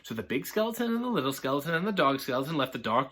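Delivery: ordinary delivery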